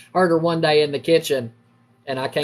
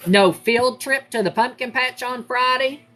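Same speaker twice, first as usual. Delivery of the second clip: high-pitched, final-word threat from mom; stern warning; 7 semitones higher than usual. Retold or imitated. Mom threatening her son with lost treats, stern. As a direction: lay down the law, pitched up. Mother retelling threatened punishment.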